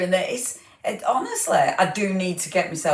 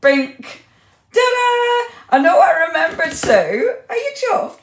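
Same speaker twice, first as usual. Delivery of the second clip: higher, louder ta-da; excited reveal; 11 semitones higher, 8 dB louder than usual. Real, not acted. Host triumphantly revealing a surprise to viewer Sue. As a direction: present it with a flourish.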